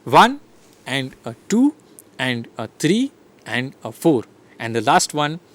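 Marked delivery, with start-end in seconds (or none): 0.0-4.2 ONE, TWO, THREE, FOUR lifted on the beat; steady beat with rising numbers; counting out a rhythm. Shown as a demonstration. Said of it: "I have been producing the rhythms".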